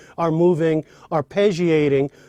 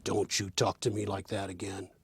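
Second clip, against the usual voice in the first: a hint of menace, low and much softer; low threatening; 6 semitones lower, 9 dB quieter than usual. Shown as a demonstration. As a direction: threaten them quietly, way down low. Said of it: "We threaten each other".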